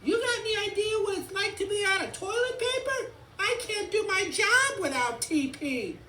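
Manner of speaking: very high, loud rant; frantic, high monotone cartoon yell; desperate race car. A narrator's reading. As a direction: wail it at the top of the pitch.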